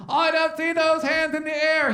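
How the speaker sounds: lightly imitating [name]. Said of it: "When he says".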